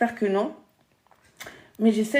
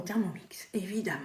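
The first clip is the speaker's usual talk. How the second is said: whispering; 4 semitones lower, 11 dB quieter than usual. Real, not acted. Aside after the Thermomix pitch.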